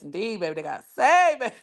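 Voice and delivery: ordinary talk, nothing marked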